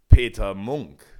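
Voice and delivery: loud, falling call of the name; booming call. A narrator's reading. Forest king addresses Peter.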